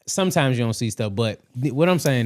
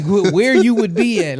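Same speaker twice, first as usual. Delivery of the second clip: excited; 8 semitones higher, 8 dB louder than usual. Real, not acted. Excited about unused potential.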